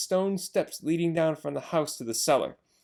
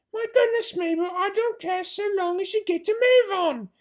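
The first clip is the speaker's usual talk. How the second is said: high, louder put-on voice, exasperated; impatient farmer's wife; 5 dB louder than usual. Missus Bean loses patience with Mabel, high exasperated voice.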